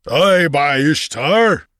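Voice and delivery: slow, high booming giant; boomed. A narrator's reading. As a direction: boom it out like a giant. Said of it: "boomed the giant".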